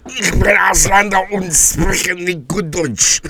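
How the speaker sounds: hammed-up German, slow and high-pitched; mock German. Showing off his German to the co-host, slow and hammed-up. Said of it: "I cannot wait to hear German".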